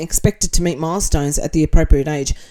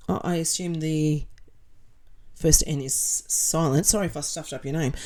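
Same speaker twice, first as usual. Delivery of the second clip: ordinary delivery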